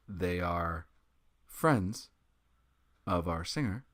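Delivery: low, soft, halting confession; grim deadpan. Retold or imitated. Musician explains Nazis to Pennywise's crew, grim deadpan. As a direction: confess it quietly, phrase by phrase.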